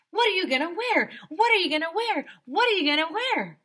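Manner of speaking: high, loud giddy chatter; excited girly squeal. Acted.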